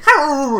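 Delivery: high, loud mock howl; imitated howl. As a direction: howl along with the dog. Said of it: "It's just me and this dog going".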